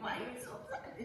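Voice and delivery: softly baffled, laughing a little; confused. Acted. Old friend puzzled by the heir's gloom, acted confusion. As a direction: ask it in puzzled, hushed disbelief. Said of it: "like, confused".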